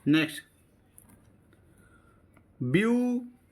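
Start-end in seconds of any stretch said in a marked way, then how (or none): 2.6-3.4 beautiful split into view, टी, फुल with pauses; syllable by syllable. Shown as a demonstration. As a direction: stretch view high, then टी and फुल apart. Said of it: "आपको बोलना है ऐसे ही मेरी तरह".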